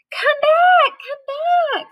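put-on high, loud old couple's call. Old man and woman chasing the cookie, high calling. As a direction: shout after the runaway cookie.

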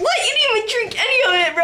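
high laughing outcry; laughing shout. Real, not acted. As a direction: protest loudly through laughter. Outraged laughing protest.

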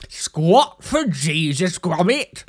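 slow, drawn-out cartoon character voice; Wallace. Vlogger mocking their Jesus accent, slow Wallace impression. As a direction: stretch the vowels in a comic impression. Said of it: "I feel like I sound like Wallace".

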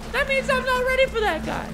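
tiny, very high falsetto; high notes. Shown as a demonstration. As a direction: pitch it way up to show nerves. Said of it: "when I'm talking like this".

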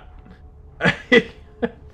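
high breathy giggle; nervous laugh. Real, not acted. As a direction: laugh off the fright.

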